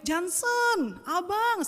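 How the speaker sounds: put-on high, loud call for a lost child; searching shout, re-enacted. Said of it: "saya teriak-teriak".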